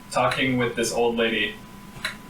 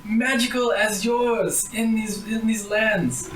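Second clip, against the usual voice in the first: put-on high, flattering old woman voice; quavering old lady, a lot higher; old granny; 12 semitones higher than usual. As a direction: gush over their beauty like a sweet granny.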